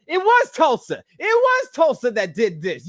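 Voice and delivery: high, loud sing-song blame; loud half-sung accusation; accusing shout. Retold or imitated. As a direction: call out the dog like a culprit. Blaming the dog loudly.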